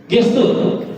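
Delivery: ordinary delivery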